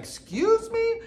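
shot up very high, offended; a shrill-free but sky-high excuse me; mock indignation. Reacting to his accusation.

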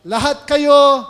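loud, high call to the crowd; shouting herald. Retold or imitated. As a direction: cry out to the revellers. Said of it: "merong sisigaw".

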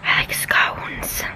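slow, drawn-out whisper; Mia Stammer. Retold or imitated. Vlogger on the rainy porch imitating another YouTuber, slow whisper. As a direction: whisper it slowly, as a playful imitation. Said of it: "I feel like Mia Stammer right now".

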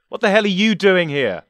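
high, loud accusing demand; loud furious accusation. Acted. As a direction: hurl the question at him. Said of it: "he asked accusingly".

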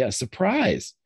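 high questioning echo of the word; surprised parents. Acted. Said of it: "turn and look and say".

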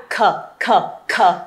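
"Khở" low, short, from the throat; throat-clearing kh. Shown as a demonstration. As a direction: hawk out "khở" like dislodging a fishbone. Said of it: "Ăn cá hóc xương khạc ra". (khạc "khở" từ cuống họng)

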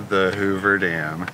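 ordinary talk, nothing marked

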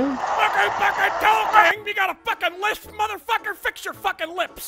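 barking drill sergeant berating a recruit; angry drill sergeant. Acted. Drill sergeant chewing out a player in the lobby. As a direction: play the foul-mouthed sergeant.

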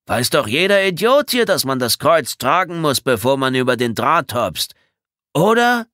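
high, loud, exaggerated denial; protesting innocence. A narrator's reading. Bedford denying to Tommy he meant harm, loud protest. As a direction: protest loudly, feigning innocence.

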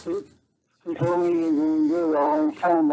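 usual speaking voice

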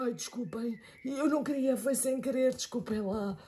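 hushed sorry; soft apology. Acted. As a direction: apologise quietly. Apologising softly.

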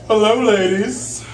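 high, slow, smooth flirt; seductive greeting. Acted. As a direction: purr it at the ladies. Posing, greeting imaginary ladies.

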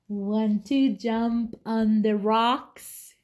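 slow, drawn-out goat voice. Acted. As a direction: stretch each word like a lazy goat. Goat asks the spider.

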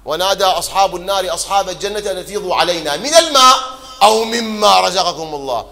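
loud chanted Quran recitation; loud verse recitation. Acted. Preacher proclaiming the verse to mourners, loud and chanted.

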